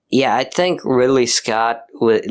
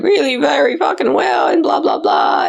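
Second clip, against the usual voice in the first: mock whine. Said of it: "he's, like, bitching".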